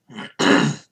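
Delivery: loud rough throat clear. Real, not acted. Throat clearing while streaming.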